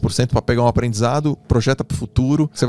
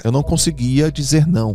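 ordinary talk

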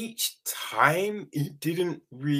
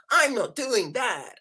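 stubborn refusal; 8 semitones higher than usual. Retold or imitated. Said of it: "and then it's like".

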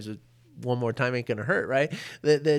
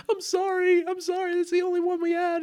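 put-on much higher, quick apologetic girl's voice; flustered young woman. Poor student guide apologizing to Ram Dass, high and flustered. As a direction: squeak out a nervous apology.

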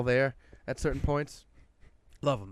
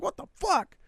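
high, strained mock yell; exasperated outburst. Shopper past caring who is looking, exasperated. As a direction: pitch it way up without added volume. Retold outburst while everybody stares.

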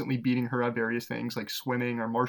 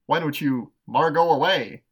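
louder, drawn-out delivery of a lame pun; Bill Hader; 2 semitones higher, 7 dB louder than usual. Acted. Teasing his sister with a lame pun, loud Bill Hader impression. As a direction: push the corny pun, slowly and loudly. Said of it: "That's also my Bill, ... Bill Hader impression".

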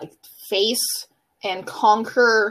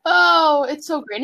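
usual speaking voice

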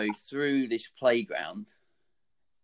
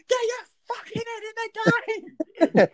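high, fast, strained shout; fast, high-pitched bellow of blame; mock furious yell; 7 dB louder, much faster than usual. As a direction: scream it across the pitch at the keeper. Teammate yelling after dropped catch.

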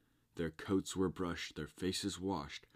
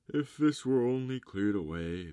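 a higher, louder storybook lament; lightly voiced wishful character; 6 semitones higher, 8 dB louder than usual. A narrator's reading. Walrus and carpenter lament all the sand, wishful character voice.

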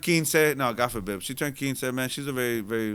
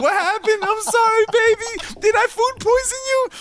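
put-on high girlfriend voice; 5 dB louder than usual. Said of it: "She's like".

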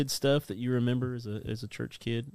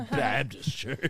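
slow, exaggerated copy with a laugh; drawn-out teasing echo, playfully aggressive; mock aggressive; 2 semitones higher, much slower than usual. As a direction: imitate someone saying it too forcefully. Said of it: "He said that really aggressively".

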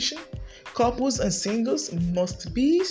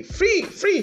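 soaring repeat; 12 semitones higher than usual. Acted. Rising declaration in prayer.

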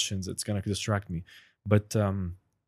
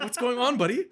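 friendly buddy greeting; 13 semitones higher, 3 dB louder than usual. Retold or imitated. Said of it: "He's your friend".

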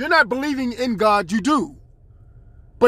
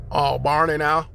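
ordinary talk, nothing marked

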